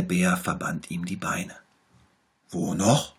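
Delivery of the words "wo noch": lightly voiced little bear, louder. A narrator's reading. Little bear promises to heal the tiger, louder. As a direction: promise it brightly like a small doctor. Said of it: "sagte der kleine Tiger".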